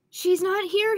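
high, loud cry of alarm; urgent storybook panic; panicked call. Acted. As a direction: call down in a panic, pitched right up. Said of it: "called down in a panic".

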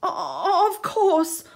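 high, nervous stammer. Acted. Octopus backing down before the shark, nervous stammer. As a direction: stumble over the words in fright. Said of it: "Octo stammered".

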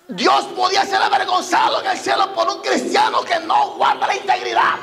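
high, forceful challenge to the congregation; impassioned preaching. Real, not acted. Preacher asking believers about shaming God, impassioned preaching. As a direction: press each question home like a preacher.

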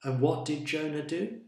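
plain reading voice

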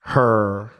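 "Her" for here, Dallas drawn-out vowel; Dallas accent. Shown as a demonstration. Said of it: "that's how people talk in Dallas".

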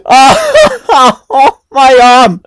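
loud, high laugh while clutching the arm; laughing in pain. Real, not acted. Hit the chair's arm, laughing and wincing. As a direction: laugh through the sting, slowly.

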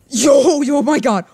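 loud, high burst of shock; astonished shout. Real, not acted. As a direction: shout out in amazement. Amazed by play in game.

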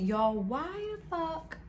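exasperated disbelief. Real, not acted. Disbelief at the story.

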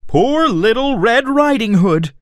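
plain reading voice

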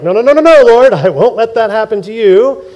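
loud, high insistent no's; loud protest. Retold or imitated. Peter loudly refusing Jesus' fate. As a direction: shout him down in denial. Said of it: "The same guy who's like".

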